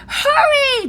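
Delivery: high, loud yell. A narrator's reading. Jack yelling at the magic as the wind rises. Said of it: "Jack yelled".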